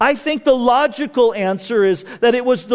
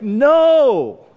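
long, drawn-out protesting no; mock dismay; 3 semitones higher, 4 dB louder than usual. Preacher as the gasping romantics, in mock dismay. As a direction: wail it like a let-down romantic, hammed-up. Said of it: "all of the romantics in the room gasp".

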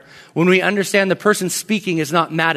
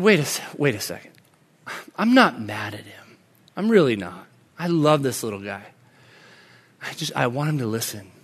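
low, softened talk to himself; retold moment of settling down, tender and quiet; calming down; 7 semitones lower, 6 dB quieter than usual. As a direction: talk yourself down gently. Retold calming self-talk after frustration.

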